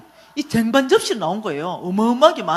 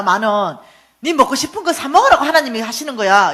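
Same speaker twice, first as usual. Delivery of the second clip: retold scolding; 2 semitones higher, 8 dB louder than usual. Mother lectures son about money.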